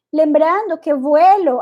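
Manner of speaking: usual speaking voice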